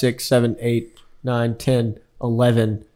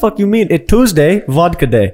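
mock Russian accent; 7 semitones higher than usual. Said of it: "how could you not have that accent".

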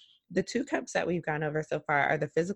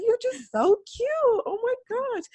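much higher, gushing cutesy voice; cooing at a friend. Retold or imitated. Teasing her crying best friend, high gushing coo. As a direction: coo like she's adorable. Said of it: "I'm like".

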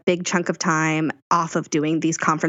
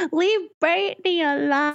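mock plea; 11 semitones higher than usual. Playful high-pitched plea.

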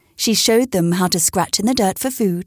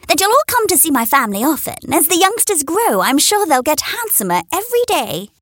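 high, quick proud hen; 8 semitones higher than usual. A narrator's reading. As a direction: invite them proudly. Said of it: "said Henrietta".

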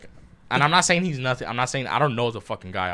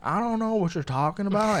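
high, mock Southern woman's voice; high-pitched Southern accent impression; Southern girl; 8 semitones higher than usual. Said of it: "she was like this Southern girl".

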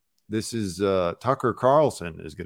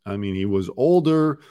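ordinary talk

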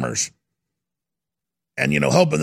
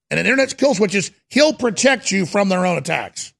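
ordinary delivery

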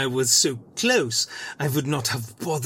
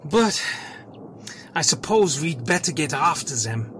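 ordinary talk, nothing marked

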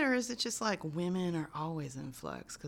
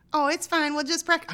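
breezy Claire; 11 semitones higher, 8 dB louder than usual. Retold or imitated. Acting out Claire's reply.

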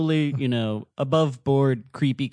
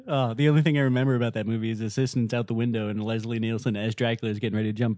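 normal talking voice

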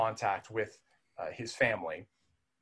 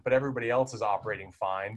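usual speaking voice

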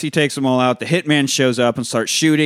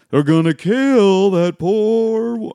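slow, drawn-out and higher movie quote; lingering higher retelling of the line; slow quote replay; 9 semitones higher, 4 dB louder, much slower than usual. Retold or imitated. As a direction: savour each word of the line slowly. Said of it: "one of my favorite fucking moments of that movie".

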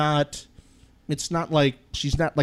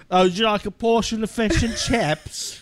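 raised, prim mock British voice; mock British accent; 7 semitones higher than usual. Host answers with his own mock British accent, higher. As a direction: offer fish and chips as a posh Brit. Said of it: "Our amazing accents?".

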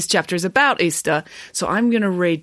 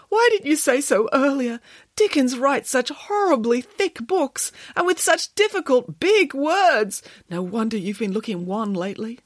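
put-on high, emotional, fussing over the boy; pitying, pitched-up storybook character; sympathetic Boris the bear; 7 semitones higher than usual. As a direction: lament it, slowing on the complaints. Boris pities Derek.

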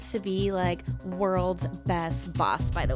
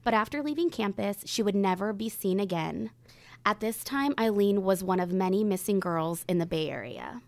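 grave true crime narration; suspenseful crime show narrator. Acted. Reads the case introduction.

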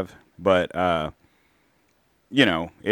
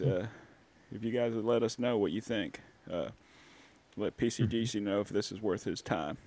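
softer, lightly voiced Bill guest; 3 semitones higher, 7 dB quieter than usual. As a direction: play the folksy guest Bill. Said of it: "Thanks, Bill".

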